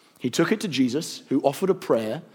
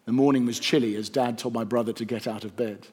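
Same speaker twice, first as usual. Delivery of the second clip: ordinary delivery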